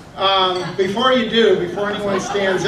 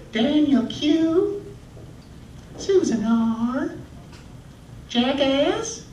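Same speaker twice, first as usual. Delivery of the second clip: high, slow Southern roll call; put-on Southern teacher voice, each name stretched; South Carolina teacher; 9 semitones higher, much slower than usual. Substitute teacher taking attendance, high South Carolina drawl. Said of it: "a substitute teacher from South Carolina".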